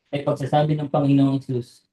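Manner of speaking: ordinary talk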